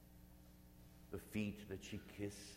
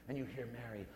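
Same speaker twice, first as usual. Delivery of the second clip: normal talking voice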